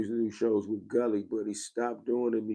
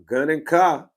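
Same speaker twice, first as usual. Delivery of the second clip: Gun and Car called out loud and higher, landing on a falling Car; announced brand call; 6 semitones higher, 10 dB louder, slower than usual. Shown as a demonstration. Streamer shouting his brand name to chat, loud and proud. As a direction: belt out the name like a chant. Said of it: "when you say Gun and Car".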